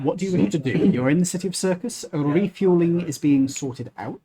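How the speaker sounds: ordinary talk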